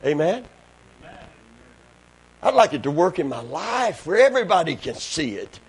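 ordinary delivery

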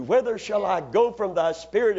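normal talking voice

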